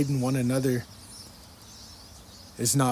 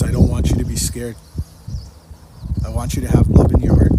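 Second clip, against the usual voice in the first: much louder, urgent plea; loud retold message; 11 dB louder than usual. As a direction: call it out like a message from above. Retelling God's message in dream.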